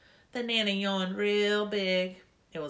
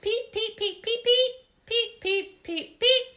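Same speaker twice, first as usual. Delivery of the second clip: string of very high squeaky peeps, louder; peeping; 8 dB louder, slower than usual. A narrator's reading. Girls peeping all over the room: squeaky chirps. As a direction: chirp like a nest of chicks. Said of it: "all of us were peeping".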